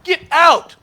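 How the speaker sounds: firm, rising to a loud get out; defiant refusal. Retold or imitated. Traore refuses the rigged game and yells them out. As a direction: turn them down and throw them out. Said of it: "Ibrahim said".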